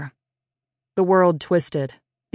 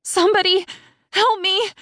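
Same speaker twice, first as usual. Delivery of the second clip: screaming for help. Acted. Tied-up girl crying out into the empty field, screaming. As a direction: scream it much higher, begging anyone to hear. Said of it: "Zoe screamed".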